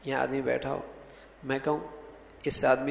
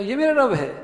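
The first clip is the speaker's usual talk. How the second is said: high-pitched disbelieving query; astonished, rejecting tone; incredulous question; 7 semitones higher, 4 dB louder than usual. Shown as a demonstration. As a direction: ask it as if it could never be so. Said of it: "لہجے کے لحاظ سے انکار".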